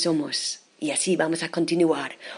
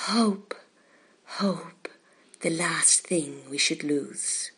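ordinary delivery

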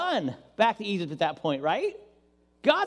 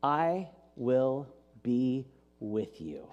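lower, softer, very slow and solemn; patient God; 7 semitones lower, 4 dB quieter, much slower than usual. Acted. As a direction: speak it like eternal, calm divine reassurance. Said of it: "God answers with the patience of eternity".

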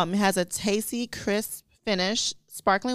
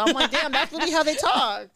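laughing; 7 semitones higher, 4 dB louder than usual. Real, not acted. Laughing at the French video.

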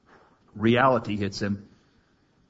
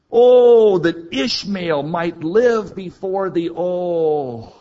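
much louder, higher, slow pleading cry; 7 semitones higher, 8 dB louder, slower than usual. Retold or imitated. Abraham pleading for Ishmael, loud anguished cry. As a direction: cry out to God for your son. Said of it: "he cries out".

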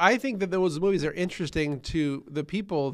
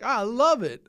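higher-pitched gush of delight; mock gushing; 6 semitones higher, 4 dB louder than usual. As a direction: imitate someone gushing happily. Gushing quote of Hollywood stayers.

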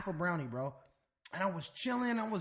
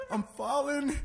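higher-pitched retold worry; mock panic; 6 semitones higher, slower than usual. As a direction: imitate her alarmed first cry. Sister's panic acted again.

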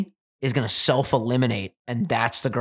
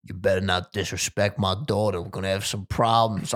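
lower, measured warning, imitating a father; stern dad; 5 semitones lower, 4 dB quieter than usual. Dad warns the bachelor about his daughter, stern. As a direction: put on a protective dad's threat. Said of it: "every dad looks this man in the eye".

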